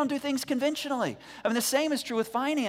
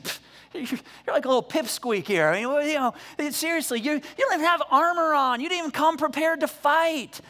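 scoffing giant; 5 semitones higher than usual. Retold or imitated. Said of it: "he's like".

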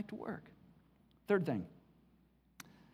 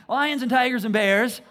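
playful echo; 4 semitones higher, 9 dB louder than usual. Acted. Preacher echoing famous phrase playfully.

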